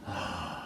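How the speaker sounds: low, soft tired sigh; weary sigh. Acted. Narrator giving up on Bruce's peace, soft sigh.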